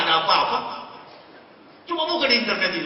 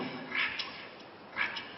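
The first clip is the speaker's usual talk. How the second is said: whisper; 18 dB quieter, much slower than usual. Retold or imitated. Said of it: "ada suara bisik sama saya".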